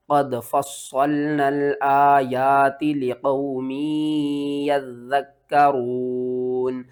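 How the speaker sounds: slow, steady tajweed with long held vowels; sustained, measured Arabic recitation; Quran recitation. Shown as a demonstration. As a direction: recite it in full, stretching each madd. Final recitation of the verse.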